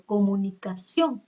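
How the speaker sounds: comunicación said slowly, final CIÓN emphasized; stress on CIÓN. Shown as a demonstration. Teacher showing the tonic syllable, stress on CIÓN in comunicación. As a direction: land firmly on the CIÓN of comunicación. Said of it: "va a ser la sílaba tónica".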